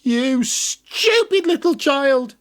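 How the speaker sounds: slow, high scornful witch voice. Acted. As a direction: sneer like a wicked witch. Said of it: "said the witch".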